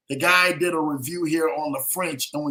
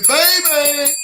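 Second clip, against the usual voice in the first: put-on big, high, loud shout of baby; Steve's hype voice; 8 semitones higher, 10 dB louder than usual. Imitating Steve's voice, hyping up a viewer. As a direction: belt it out like a hype man. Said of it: "you gotta know Steve in that voice".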